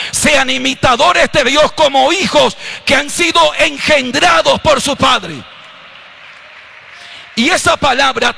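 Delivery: shouting the scripture from the pulpit; full-force preaching. Acted. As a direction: thunder the verse at the congregation.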